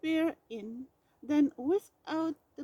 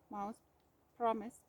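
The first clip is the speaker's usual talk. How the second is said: ordinary delivery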